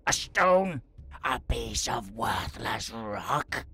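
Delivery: high, indignant troll outburst; angry troll. Acted. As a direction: spit it out in disbelief. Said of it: "spouted in anger".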